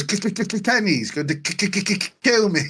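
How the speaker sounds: stuttering mock character. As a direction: imitate a heavy stutter on K. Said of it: "he goes".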